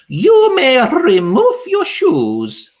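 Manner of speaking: very high, loud, slow witch bark; harsh Grand High Witch order; barked command. A narrator's reading. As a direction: bark it at the room. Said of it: "barked the Grand High Witch".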